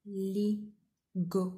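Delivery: "Illegal" very slow, each syllable apart; teacher counting syllables. Shown as a demonstration. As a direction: break "Illegal" into its beats. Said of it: "three syllables".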